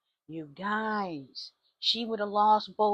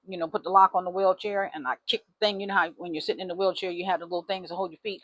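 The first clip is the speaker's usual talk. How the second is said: normal talking voice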